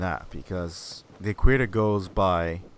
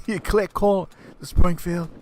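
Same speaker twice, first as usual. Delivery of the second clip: scolding father; 9 semitones higher, 5 dB louder, slower than usual. Retold or imitated. Father voiced questioning.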